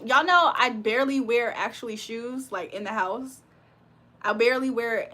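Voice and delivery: ordinary talk, nothing marked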